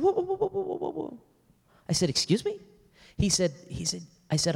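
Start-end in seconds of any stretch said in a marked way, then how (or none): 0.0-1.2 stunned, very high repeated whoas; retold shocked exclamation. Pastor hears shocking confession. 2.1-2.6 incredulous. Retold or imitated. Pastor reacting to confession.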